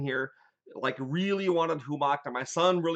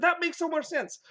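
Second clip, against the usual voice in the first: high, bright-eyed "so much sense"; sudden excited understanding, pitched way up; delighted realisation; 13 semitones higher than usual. Retold or imitated. Retelling own delighted reaction.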